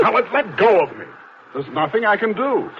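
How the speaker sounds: strained protest. Acted. Pushing off grabbing woman.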